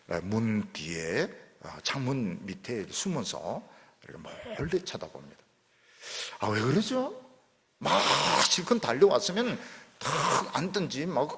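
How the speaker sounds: normal talking voice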